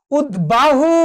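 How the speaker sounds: উদ্বাহু said high as ud-bahu, b fully sounded; b fully pronounced. Shown as a demonstration. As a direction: split উদ্বাহু after the d, then a clear b. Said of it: "উচ্চারণ হয়েছে।".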